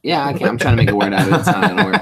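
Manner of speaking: laughing through the words; breaking up with laughter. Real, not acted.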